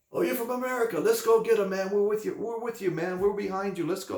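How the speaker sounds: cheering Aussie locals. Retold or imitated. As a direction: pump him up like a fired-up mate.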